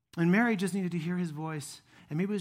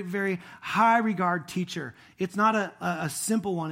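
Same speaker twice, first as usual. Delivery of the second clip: ordinary talk, nothing marked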